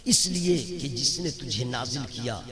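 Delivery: loud, driving sermon delivery; rousing preacher at full voice; impassioned preaching. Real, not acted. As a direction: hammer the questions home to the congregation. Preaching at full force to crowd.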